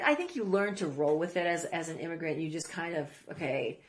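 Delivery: normal talking voice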